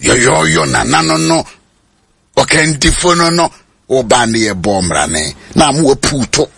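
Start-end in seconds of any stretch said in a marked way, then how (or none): none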